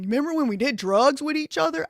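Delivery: high, loud mock voice of him reminiscing; mock incredulous quote. Streamer mocks a guess about his words, hammed-up high voice. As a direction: ham up the guy asking about drugs. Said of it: "I don't think he was saying".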